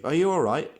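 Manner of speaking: high, loud alarmed question; acted burst of worry; panicked. A narrator's reading. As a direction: ask it with panic in the voice. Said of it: "with panic in his voice".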